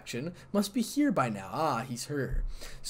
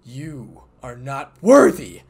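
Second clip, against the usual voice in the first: much louder, slow and booming; booming verdict; 15 dB louder, slower than usual. Acted. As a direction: thunder the judgment down on her. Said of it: "All I got was a loud".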